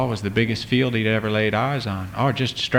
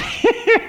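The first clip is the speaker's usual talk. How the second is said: put-on parents laughing off the boy's tale; mocking laugh. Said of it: "smirky grin".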